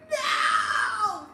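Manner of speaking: very high crying shout; hammed-up desperate plea; mock crying wail. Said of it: "I'm telling, like".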